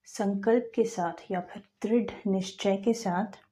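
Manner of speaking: ordinary talk, nothing marked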